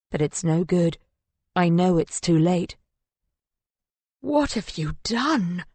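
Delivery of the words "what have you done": slow, high-pitched horror; anguished. A narrator's reading. Husband turning on the woman beside his wife's body, anguished. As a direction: drag the question out in disbelief. Man realises his wife is dead.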